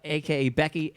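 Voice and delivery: ordinary delivery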